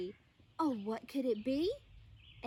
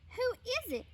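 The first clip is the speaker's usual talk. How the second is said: puzzled; 10 semitones higher, much faster than usual. Acted. Bear asking, puzzled.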